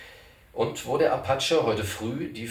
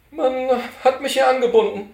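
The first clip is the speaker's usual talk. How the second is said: put-on fussy old cantor; 9 dB louder than usual. Cantor correcting Poller about his title, high and fussy. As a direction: insist on your proper title, prissily.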